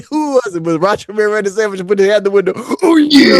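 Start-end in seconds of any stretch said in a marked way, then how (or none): 2.5-3.4 loud, high wrestler catchphrase; hammed-up wrestler yell; Macho Man. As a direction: bellow it like a ring promo. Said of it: "that was Macho Man".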